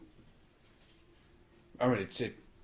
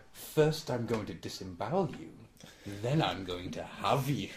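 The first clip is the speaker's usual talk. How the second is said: evil Rimmer voice; 4 semitones lower, 8 dB quieter than usual. Retold or imitated. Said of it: "That version of Rimmer".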